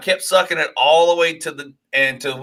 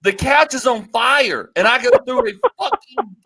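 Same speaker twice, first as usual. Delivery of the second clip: building excitement; 10 semitones higher than usual. Real, not acted. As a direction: hit the punchline hard. Telling the climax of story.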